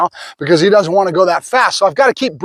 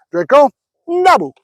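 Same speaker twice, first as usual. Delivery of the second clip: command to the dog; 5 semitones higher than usual. Real, not acted.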